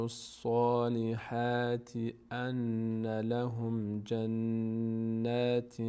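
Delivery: slow, melodic tajwid chant; Quran recitation. Acted. Teacher reciting the verse to the class. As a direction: recite it with long drawn vowels.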